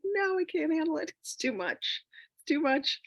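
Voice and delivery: high-pitched mock panic; overwhelmed refusal. Retelling her refusal to meet her richer self, mock-panicked squeal. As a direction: play being overwhelmed, voice jumping up high.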